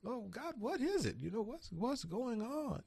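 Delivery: pleading with the Lord, pitch climbing; rising plea to God. Retold or imitated. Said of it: "I said".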